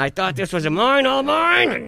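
high, drawn-out cry of protest; mock-outraged wail. Songwriter protesting shared credit, mock-outraged wail. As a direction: wail it loudly, stretching each MINE. Acting his dismay at sharing credit.

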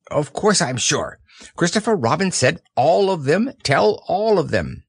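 high, fussy owl; bright know-it-all bird. Acted. Owl speaking.

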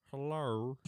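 lightly imitated Australian hello; a drawn-out Aussie hello. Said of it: "Australian people say hello".

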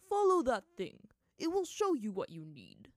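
very loud, put-on monkey voice; monkey spirit, dismissive. A narrator's reading. Monkey spirit directs Aang away, dismissive. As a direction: boom it out to get rid of them.

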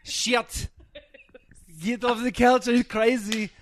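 hammed-up Russian accent, high and yelling; ranting like an angry Russian woman. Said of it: "That was my Russian accent".

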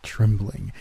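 usual speaking voice

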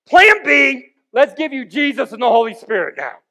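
loud, high mock-God proclamation; hammed-up shout, easing slightly after the first words; mock sudden announcement. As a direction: shout it like a sudden backup idea. Acts out God announcing a backup plan.

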